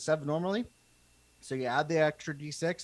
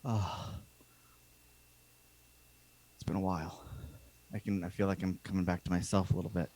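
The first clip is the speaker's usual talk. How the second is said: soft, lower relieved sigh voice; relieved NPC; 6 semitones lower, 6 dB quieter than usual. Acted. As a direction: breathe it out like relief returning.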